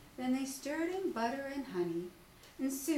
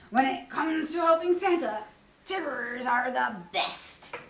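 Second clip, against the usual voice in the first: loud, slowed brag; Tigger boasting; 10 dB louder than usual. Acted. Tigger boasting to friends, loud. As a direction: boom it out proudly. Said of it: "cried Tigger".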